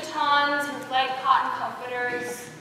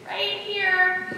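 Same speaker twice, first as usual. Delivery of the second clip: acted reply; 7 semitones higher than usual. Acts out pointing herself out.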